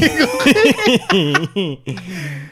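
bursting into laughter; loud laugh. Real, not acted. Bursts out laughing mid-story.

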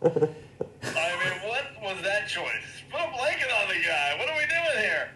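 climbing high-pitched exasperation; incredulous protest. Acted. Mock outrage at a production choice.

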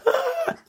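thin high-pitched shriek; mock scream. Republicans reacting to Joe Biden, thin mock shriek. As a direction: squeal out a pretend scream.

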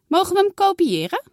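put-on a child asking a favour; eager girl.

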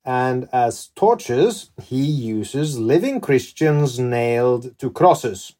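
measured, theatrical storytelling with emphatic peaks; dramatic story narration. Acted. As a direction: narrate it like a dark historical tale. Host reads scripted story to guest.

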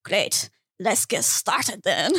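low, slow, cartoonish French accent; terrible French accent. Clown answering the men, low and badly faked French accent. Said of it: "I'm not good at accents".

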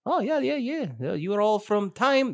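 high attempted Scottish slipping into Russian; muddled Russian-sounding crewman; put-on high voice with a stray Russian accent; accidental Russian accent. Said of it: "that's Russian".

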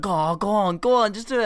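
mock pleading. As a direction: pester your mate into doing it. Friend begging him to order.

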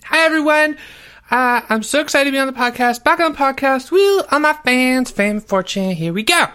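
high-pitched, gushing greeting; over-excited, squealy podcast hello; high-energy guest. Acted. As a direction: whoop and gush like a thrilled kid guest. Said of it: "a lot more energy".

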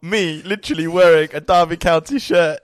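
excited. Real, not acted. Excited disbelief.

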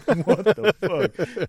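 slow, high-pitched mock astonishment; retold stunned disbelief. Viewer watching flames shoot through Rambo, stunned disbelief. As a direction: draw it right out, dumbfounded.

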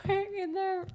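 high-pitched giggle through the words; breaking up laughing mid-sentence. Real, not acted. Friend laughing while starting her wedding disaster story.

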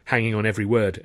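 ordinary delivery

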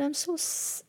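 high, soft break mid-word. Real, not acted. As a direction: let the word crack and stop. Voice breaks mid-word while crying.

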